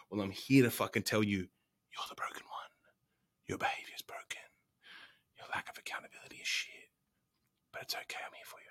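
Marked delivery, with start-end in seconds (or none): 1.4-8.7 teasing stage whisper. Pretend. Coach confides to his clients in a mock whisper. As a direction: lean in and whisper the harsh truth.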